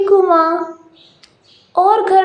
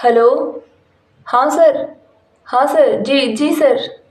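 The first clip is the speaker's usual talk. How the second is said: lowered male voice, deferential; husband on the phone; 3 semitones lower than usual. Acted. Husband takes a call from a sir, lowered deferential male voice. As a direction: answer the boss politely, low.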